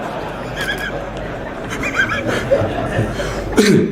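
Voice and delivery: long high wheezy laugh. Real, not acted. Preacher amused by hopeless committee letters, laughing. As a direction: laugh helplessly at your own joke. Laughs at his own joke.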